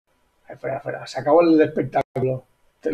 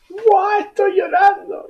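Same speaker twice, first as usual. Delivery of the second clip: mock crying; 6 dB louder than usual.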